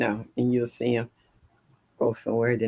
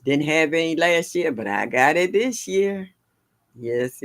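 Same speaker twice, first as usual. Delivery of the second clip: ordinary talk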